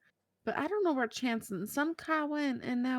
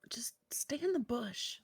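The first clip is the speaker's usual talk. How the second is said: hushed whisper to a friend; 9 dB quieter than usual. Acted. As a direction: whisper it so the guards can't hear. Hiding from guards, tells Aaron.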